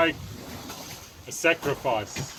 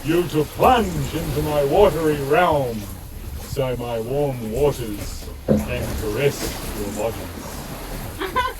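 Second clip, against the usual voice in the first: booming warrior king; 3 semitones higher than usual. Acted. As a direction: thunder the demand down from the throne. King gives orders.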